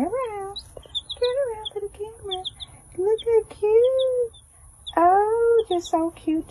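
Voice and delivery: high, sing-song coo at tiny animals. Real, not acted. Chick owner coaxing her babies toward the camera, high-pitched cooing.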